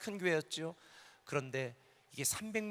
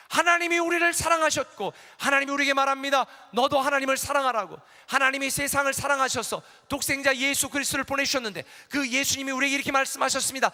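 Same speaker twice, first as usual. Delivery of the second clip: much higher, much louder fervent preaching; preaching at full force; 8 semitones higher, 10 dB louder than usual. Acted. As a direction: build the sermon to its climax. Climax of sermon.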